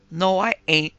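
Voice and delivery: loud, resentful retort; resentful boy. A narrator's reading. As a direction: snap back, stung. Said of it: "replied the boy resentfully".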